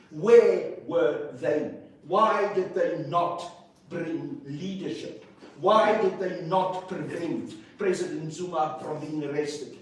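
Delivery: loud, slow, furious demand; getting angry. Real, not acted. On air to the interviewer, demanding where ANC leaders were: loud slow anger. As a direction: hammer each question, slowly and loud. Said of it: "for getting angry".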